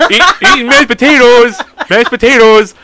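loud, high goofy chanting; over-the-top put-on character. Keeping up the act at family dinner, loud goofy put-on voice. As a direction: belt it out in the silly pretend voice. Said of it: "I'm sitting there, like".

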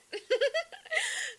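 a burst of high-pitched giggling; high squealing laugh. Real, not acted. Giggling to viewers about yoga pose. Recalling happy baby pose.

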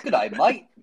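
a quick, light mock Australian "Good day, mate"; Aussie accent. Mock Aussie greeting explaining how Australians talk. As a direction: toss off a breezy Aussie greeting. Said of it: "The Aussies are more like".

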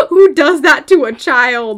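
slow, loud outraged question; loud indignation. Real, not acted. Former student outraged at her choir director, loud indignant outburst. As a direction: burst out in disbelief, stretching the words.

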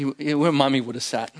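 ordinary talk

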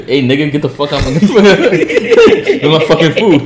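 loud, high cursing through laughter; hammed-up scolding, breaking into laughs; laughing mock outburst. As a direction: play an angry dog while cracking up. Cursing dog, speaker cracking up.

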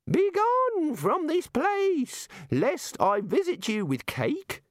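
very high, slow mock decree; pompous god. A narrator's reading. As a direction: declaim grandly in a squeak. God repeats threat.

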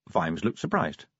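ordinary delivery, nothing marked

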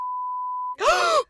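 sharp offended gasp; a loud shocked intake of breath. Real, not acted. Reacting to insult.

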